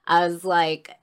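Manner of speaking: usual speaking voice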